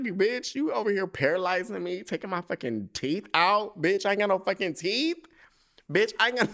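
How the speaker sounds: high mock outrage dissolving into laughs; anger turning to laughter. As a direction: scold her mom, then crack up. Voices the daughter scolding mom.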